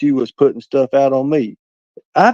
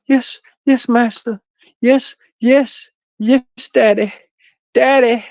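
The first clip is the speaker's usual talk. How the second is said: high-pitched, pleading "yes master" voice; mock submissive servant; 11 semitones higher, slower than usual. Livestreamer mimicking someone calling him master, mock submissive. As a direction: squeal it like an eager, fawning minion. Acting out a submissive follower on stream.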